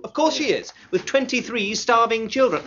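high, mock-pitying exaggeration; sarcastic agreement. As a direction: pitch it up and mock the sob story. Mocks typist's sympathy for tenant.

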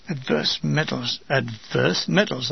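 slow, low, soft chant; hushed, measured incantation; intoned. A narrator's reading. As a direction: intone it repeatedly, lingering on each word. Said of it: "I intoned repeatedly".

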